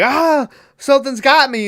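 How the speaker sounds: high, loud fake cry for help; mock horror-movie panic. Prankster fooling the cameraman, faked panicked cry. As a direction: cry out in hammed-up alarm. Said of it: "the classic horror movie thing".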